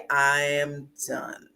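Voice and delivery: subdued, final refusal; quiet finality. Acted. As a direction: draw it out, low-key and resolved. Acts out reaching the final limit.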